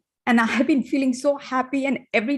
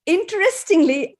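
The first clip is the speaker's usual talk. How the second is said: usual speaking voice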